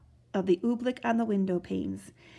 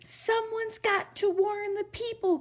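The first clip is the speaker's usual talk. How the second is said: urgent cry; 11 semitones higher than usual. Acted. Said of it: "cried Bartholomew".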